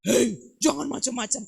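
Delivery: loud, sharp warning shout; harsh scolding. Shown as a demonstration. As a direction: scold a child loudly. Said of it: "Suara kita kalau suara". (bentakan keras memarahi anak)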